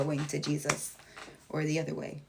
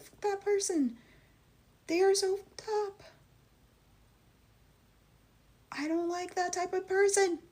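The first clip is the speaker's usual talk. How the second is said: much higher, scornful, fed-up tone; blunt disgust; 12 semitones higher than usual. Retold or imitated. Her honest take on hateful people, high and scornful. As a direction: spit out contempt, pitched up high. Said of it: "No, I'm gonna be like".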